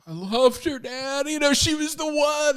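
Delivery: a son's broken-hearted confession to his father; heartbroken son. Retold or imitated. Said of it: "I wanna go and talk to him and be like".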